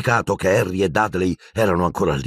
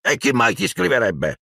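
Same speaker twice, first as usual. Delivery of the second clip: a touch of hissing scorn; uncle's scornful hiss; hissed sneer; 5 semitones higher than usual. A narrator's reading. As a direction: sneer it at the boy. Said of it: "sibilò zio Vernon".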